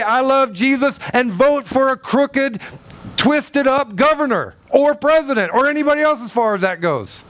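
high, driving sermon voice; fiery preaching. Acted. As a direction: preach it hard to the pews. Preaching at full force.

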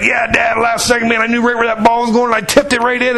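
imitated boy's high, rushing brag; all excited. Son telling Dad about his winning point, imitated excitement. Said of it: "the son was all excited".

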